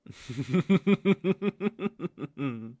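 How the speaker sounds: high, loud laugh at her fear; a villain relishing his prey's fear; cruel laugh. Acted. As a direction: laugh at the frightened lamb.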